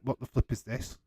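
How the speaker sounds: ordinary talk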